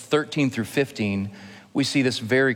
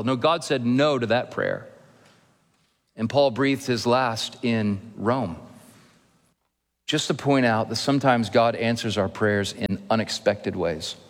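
ordinary talk

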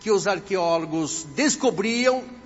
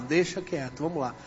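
low, softer retreat; subdued little aside of a cowed believer; startled, backing off; 6 semitones lower, 6 dB quieter than usual. Retold or imitated. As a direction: drop the voice to let the matter go. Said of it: "assustado, falou".

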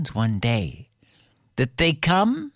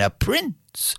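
ordinary delivery

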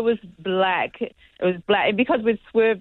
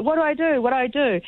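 flustered panic; 8 semitones higher than usual. Retold or imitated. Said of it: "she's like".

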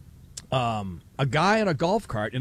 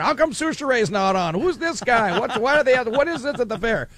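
higher-pitched run of baffled questions; complaining callers; 7 semitones higher than usual. Retold or imitated. People calling the radio station about the new show, complaining. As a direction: imitate listeners phoning in to complain.

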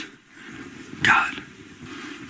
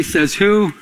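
ordinary talk

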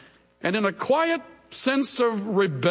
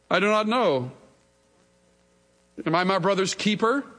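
defiant reply; 3 semitones higher than usual. Acted. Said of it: "in living color defiance and rebellion".

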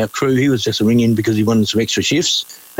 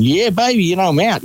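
imitated cheering; 7 semitones higher than usual. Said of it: "I'm gonna be going".

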